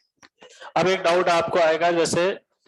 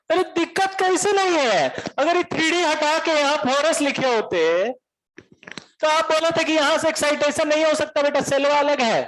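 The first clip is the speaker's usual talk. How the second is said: much higher, heated Bihari rant; Bihari student yelling; 7 semitones higher than usual. Retold or imitated. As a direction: shout the argument at the teacher. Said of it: "काहे चिल्ला रहे इतना?". (बिहारी छात्र का चिल्लाकर बहस करना)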